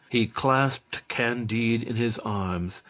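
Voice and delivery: ordinary delivery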